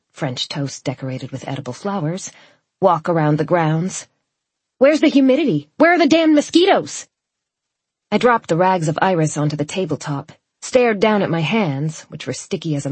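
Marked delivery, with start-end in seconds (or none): 4.8-7.1 high, loud indignant demand; exasperated outburst. A narrator's reading. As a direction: cry out in exasperation, building on the second question. Grumbled outburst at too perfect weather.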